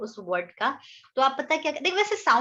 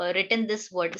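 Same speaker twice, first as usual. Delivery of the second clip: ordinary talk, nothing marked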